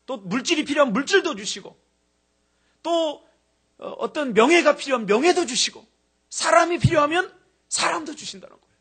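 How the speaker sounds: loud, high, driving sermon voice; full-force preaching. Acted. Preacher exhorting the congregation, loud and high. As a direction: preach it hard, building.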